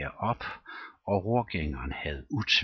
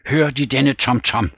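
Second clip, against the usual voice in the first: urgent question; 6 semitones higher, 8 dB louder than usual. Acted. Rom, fearful, asks about drums.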